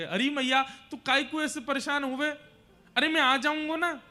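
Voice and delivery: high, loud, insistent reassurance; pleading, consoling son. Acted. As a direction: urge a crying mother not to worry. Son consoles his weeping mother. (माँ को मनाते बेटे की ऊँची आवाज़)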